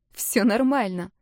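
ordinary delivery, nothing marked

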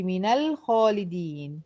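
slow "من الخالدين" as mina-lkhalidin; measured tajweed example, "من الخالدين" joined by a; demonstrating the fatha link. Shown as a demonstration. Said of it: "هتقولي".

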